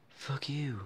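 voiceless whisper, breath only; whispered insult. Acted. Vlogger whispering at his rival Thomas, voiceless whisper. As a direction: hiss it under your breath.

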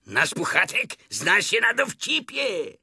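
high, loud admiring tiger. A narrator's reading. Tigger praising Pooh's wit, high loud admiration. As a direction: marvel aloud in a high voice. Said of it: "z podziwem rzekł tygrys".

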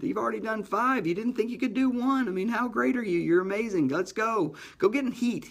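high, coaxing voice of the quitter in his head; tempting inner voice. Retold or imitated. The injured athlete hearing his quitter brain flatter him, high and coaxing. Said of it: "Now I got all the reason in the world".

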